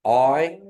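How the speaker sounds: loud clear oi; teacher drills the oi sound; Kiowa oi. Shown as a demonstration. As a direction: call oi out for the class. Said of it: "there you go".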